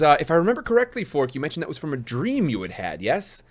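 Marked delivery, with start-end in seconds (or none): none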